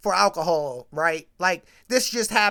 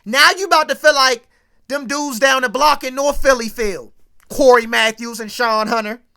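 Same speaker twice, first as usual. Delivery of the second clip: ordinary delivery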